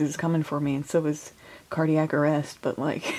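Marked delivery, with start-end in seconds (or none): none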